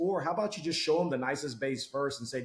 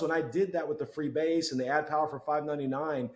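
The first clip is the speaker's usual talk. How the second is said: ordinary delivery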